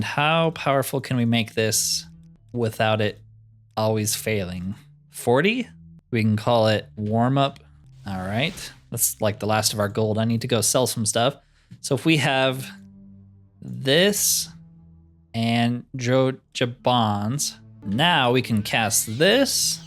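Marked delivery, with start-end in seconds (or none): none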